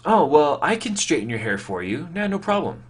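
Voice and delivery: lightly imitating a sister who is sure of herself; older sister offering. Teen retells older sister offering to straighten his hair, mock breezy. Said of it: "and she says".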